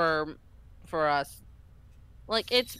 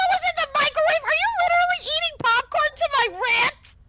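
very high, indignant squeal; outraged high-voiced question; incredulous outburst; 4 dB louder, faster than usual. Real, not acted. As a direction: demand it in high-pitched disbelief. Outraged at popcorn during rant.

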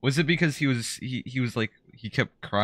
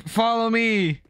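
calling out; 10 semitones higher, slower than usual. Real, not acted. Calling out to teammates.

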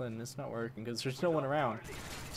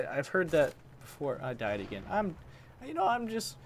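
ordinary talk

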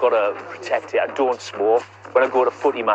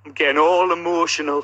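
hammed-up teary voice, higher; mock emotional; 6 semitones higher than usual. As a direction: say it as if choking up, tongue in cheek. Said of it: "can you say the word all emotional again".

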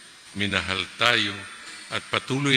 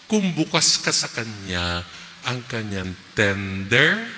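ordinary talk

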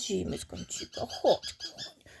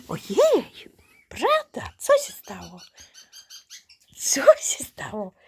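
sudden high alarmed cry; startled; 4 dB louder than usual. Real, not acted. Owner startled by a yelping puppy, high and loud. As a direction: rush to the hurt puppy.

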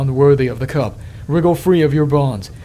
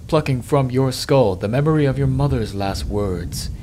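ordinary talk

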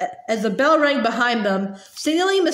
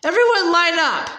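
mild roar, pitched up; roaring to crowd; 5 semitones higher than usual. A narrator's reading. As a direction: call out a mocking announcement, higher but not full volume. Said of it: "Malfoy roared to the, roared to the crowd".

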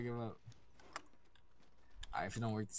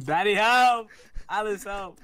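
yelling for help; 8 semitones higher, 9 dB louder, much slower than usual. Pretend. Friend dangled, begging for help.

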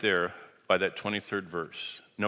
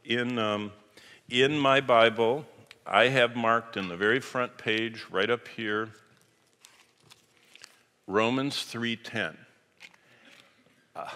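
usual speaking voice

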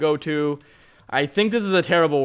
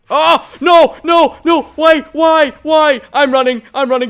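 real yelling, much higher and louder; panicked shouting; 10 semitones higher, 10 dB louder than usual. Real, not acted. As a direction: scream it out in panic. Explosions in game, panicked yelling.

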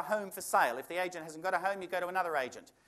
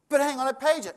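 indignant protest; 8 semitones higher, 5 dB louder than usual. Retold or imitated. Customer indignant.

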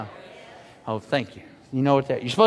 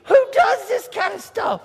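much higher, exasperated cry; mock disbelief; 10 semitones higher than usual. Pastor reacting to Becky's spider bite, high disbelief. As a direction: act out throwing up your hands. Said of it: "I'm like".

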